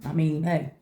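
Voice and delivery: usual speaking voice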